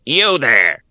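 high, loud grating goblin shout; goblin call. Acted. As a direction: bark it out like a goblin. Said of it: "called a goblin's voice".

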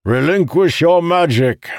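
high, loud, forceful order; shouted command. A narrator's reading. Commander ordering the magic released, shouting. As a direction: project the order to a whole crew. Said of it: "Calamir shouted".

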